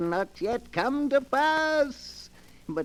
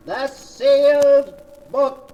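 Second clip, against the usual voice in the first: much louder, ringing out the name; booming title call; 4 semitones higher, 10 dB louder than usual. Acted. Keeper of the book closing the broadcast, booming proclamation.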